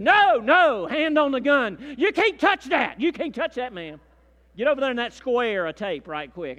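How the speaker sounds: high, loud commanding no; retold guard's shout. Airport guard yelling at the wife not to touch the bag, loud. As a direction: order her back like a guard with a hand on the gun.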